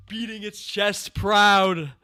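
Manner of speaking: high, loud, slow and scornful; indignant emphasis. Real, not acted. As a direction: hammer the words with scorn. Mocking the show's pride.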